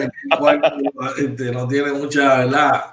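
ordinary talk

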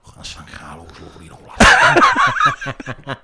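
drawn-out, laughing Scouse mate; Scouse mate impression. Comedian imitating his mate praising San Carlo, laughing. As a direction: ham up the mate raving about the restaurant. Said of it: "and he'd go in".

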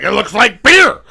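high, loud burst rising on BEER; exaggerated stock line. Shown as a demonstration. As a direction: blurt the obvious answer, hammering "beer". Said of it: "what does that look like?".

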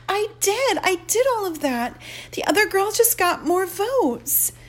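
high, small voice like a kindergartner; small childlike voice. Acted. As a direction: shrink into a little kid's voice. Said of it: "a voice that sounds like a kindergartner".

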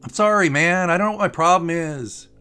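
loud, high, pleading apology; called-out apology. Acted. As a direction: call it through closed shutters. Pilot calls apology below deck.

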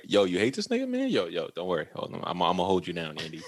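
low, soft conspiratorial murmur; quiet low voice of a sidekick; hushed reassurance. Retold or imitated. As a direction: play the loyal buddy, dropped low and quiet. Chucky reassures the kid in secret.

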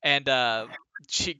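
ordinary talk, nothing marked

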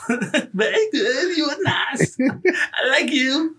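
laughing through a comic foreigner impression; Borat. Shown as a demonstration. Showing friends the only accent he can do, a Borat voice. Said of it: "I can only do fucking Borat's voice".

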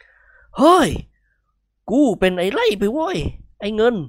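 bull calling its partner; bull character voice. A narrator's reading. Bull speaking to bull. (ไอ้เขียวพูดกับไอ้เงินแบบวัว)

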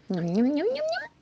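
high little nibbles, climbing; munching noises. Acted. Storyteller plays the mouse taking a smaller bite, munching noises. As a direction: imitate tiny bites in a small high voice.

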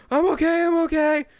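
put-on high, loud, dazed kid voice; dazed teen. Fan imitating knocked-out Spider-Man, high and dazed. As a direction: insist you're fine after a hit.

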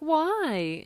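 high, loud, incredulous yelp of a question; shocked why. Retold or imitated. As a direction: fire it back as a shocked friend. Friends react in shocked disbelief.

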